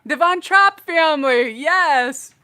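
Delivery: loud, high cry of recognition; excited. Real, not acted. Naming the Sound of Music family, excited and loud.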